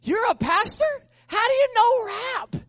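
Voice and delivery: high, loud, incredulous outburst; freaking out. Retold or imitated. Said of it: "he's freaking out".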